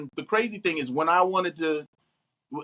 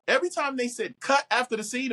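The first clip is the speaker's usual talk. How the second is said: normal talking voice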